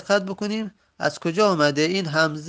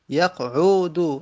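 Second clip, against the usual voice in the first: یقعدو stretched out slowly, a little louder; slow model reading; 4 dB louder, much slower than usual. Shown as a demonstration. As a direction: repeat یقعدو slowly so they hear the vowels. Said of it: "عین حرف سوم دمه داره". (یقعدو را آهسته تکرار کن)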